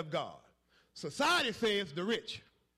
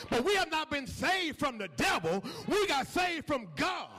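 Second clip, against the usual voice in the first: fiery gospel preaching; preaching at full force; 6 semitones higher than usual. Acted. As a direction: hammer home the warning, then the relief. Pastor preaching over organ.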